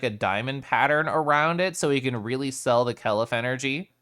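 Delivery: ordinary talk, nothing marked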